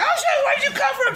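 high startled yelp, fast. Retold or imitated. Man in van startled by hidden woman, high yelp. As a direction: jump out of your skin mid-drive.

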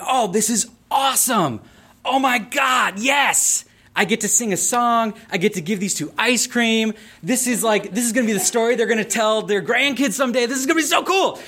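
high, giddy rush of delight; bubbling over with excitement; gleeful excitement. Retold or imitated. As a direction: squeal it like a thrilled theater kid. Excited kid imagining the proposal.

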